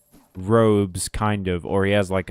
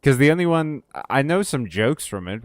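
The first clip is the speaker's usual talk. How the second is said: ordinary talk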